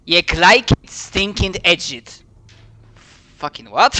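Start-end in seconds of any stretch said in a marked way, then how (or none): 0.1-2.2 loud hammed-up Scottish dwarf; Scottish accent. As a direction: shout it in broad Scots. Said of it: "he would be responding like".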